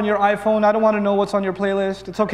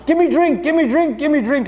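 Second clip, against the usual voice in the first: throat yelling; 8 semitones higher than usual. Pretend. Thirsty throat yelling at the fasting man. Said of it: "Your throat starts yelling".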